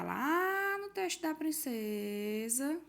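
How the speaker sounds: "lá" bem alongado, arrastado; palavras esticadas. Real, not acted. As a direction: estique o "lá" e o fim de "princesa". Manda os alunos voltarem ao texto. (fala esticada, cantarolada)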